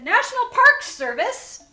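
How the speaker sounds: loud, high, sing-out announcement; ranger calling out. Retold or imitated. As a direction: call it out brightly through a car window. Ranger announcing herself at the car.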